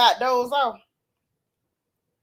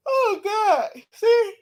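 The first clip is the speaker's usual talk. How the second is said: talking through a real yawn, pitched up at first; 5 semitones higher than usual. Real, not acted. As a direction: let a yawn swallow the words. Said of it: "I yawn".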